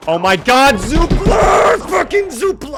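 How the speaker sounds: high, very loud scream of horror; panicked scream. Real, not acted. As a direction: scream the name out in real shock. Sudden death in the game.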